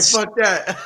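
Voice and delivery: scoffing through a laugh; laughing mock dismissal. Host laughing at a friend who skips birthdays, mock scoff. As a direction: toss it off mid-laugh, amused.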